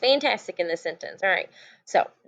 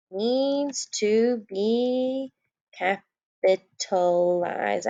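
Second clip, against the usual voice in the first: drawn-out emphasis; much slower than usual. Shown as a demonstration. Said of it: "teacher stresses the rule".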